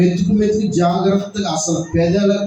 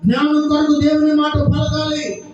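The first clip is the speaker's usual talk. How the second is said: raised, level chant-like preaching; preaching refrain; 5 semitones higher than usual. Acted. Preacher urging congregation to speak God's word, insistent refrain. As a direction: drive each repeated line home on a held high note.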